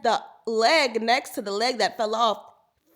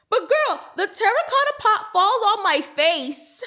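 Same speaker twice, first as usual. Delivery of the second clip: much higher and louder, storytelling peak; excited outburst; 10 semitones higher, 7 dB louder than usual. Real, not acted. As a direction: hit the climax loud and high. Dramatic climax of mishap story.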